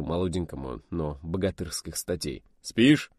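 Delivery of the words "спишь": high, loud needling question; loud teasing jab. Acted. Pilot teasing the radio operator with a loud call. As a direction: poke at him with a shout.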